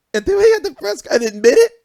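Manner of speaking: loud high-pitched wail; mock anguish. Fan recalling the press conference confession, mock anguish. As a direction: cry it out in hammed-up disbelief.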